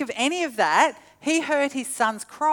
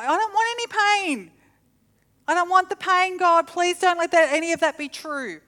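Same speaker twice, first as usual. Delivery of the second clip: higher, whiny begging prayer, hammed up; mock selfish prayer; 7 semitones higher than usual. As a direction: beg God like a scared patient. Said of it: "that was quite a selfish prayer".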